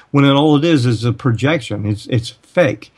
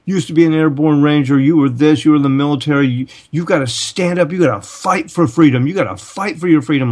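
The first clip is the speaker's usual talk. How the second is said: higher, insistent mock urging; imitated upset urging; 4 semitones higher than usual. Upset people urging the ex-ranger to fight, imitated insistently. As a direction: imitate someone upset, pressing the point in a raised pitch. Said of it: "kind of upset with me".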